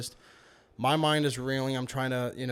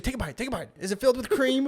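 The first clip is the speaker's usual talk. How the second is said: higher-pitched imitation of insistent mom; eager mom impression; 9 semitones higher, faster than usual. Mom urging son to bite the donut, eager high voice.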